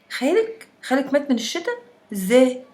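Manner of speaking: high, loud shocked disbelief; stunned disbelief. Acted. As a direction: gasp out the question in shock. Stunned at uncle's death.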